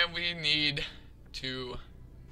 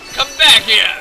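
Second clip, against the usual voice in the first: loud, higher yell; calling out; 7 semitones higher, 12 dB louder than usual. Pretend. Player calls after the escaping enemy, loud call. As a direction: yell after it to come back.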